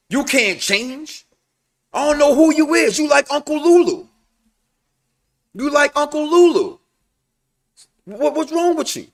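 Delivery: loud, high mock complaint; mock upset son. Acting out son's scolding of mother.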